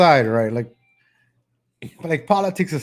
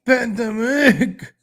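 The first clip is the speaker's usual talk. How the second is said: mock yell; 7 semitones higher, much slower than usual. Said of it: "Like, she's yelling".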